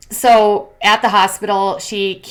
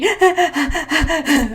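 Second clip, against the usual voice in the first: giggle; 7 semitones higher than usual. Real, not acted. Laughs after parody.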